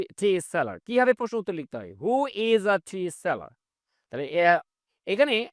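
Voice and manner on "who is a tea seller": very slow, word by word; spaced-out classroom read; slow model reading. Shown as a demonstration. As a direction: dictate each English word slowly for learners. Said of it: "slow model sentence".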